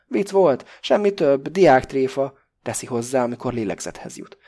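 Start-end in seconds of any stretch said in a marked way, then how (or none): 0.1-2.3 loud, high protest after gasping; breathless protest. A narrator's reading. Pakó protests, loud and breathless. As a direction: push it out, catching breath. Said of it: "levegő után kapkodva".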